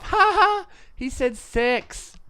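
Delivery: high, slow mocking giggle; mock schoolkid laugh. Retelling schoolkids mocking a Kiwi, high and giggly. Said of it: "you would laugh".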